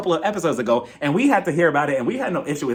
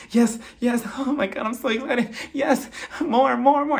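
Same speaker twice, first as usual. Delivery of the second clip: high-pitched mock little-girl excitement; thrilled like a kid in a candy factory; squeal-like eager imitation, pitched well up; [name]; 6 semitones higher than usual. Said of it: "like a little girl in a candy factory".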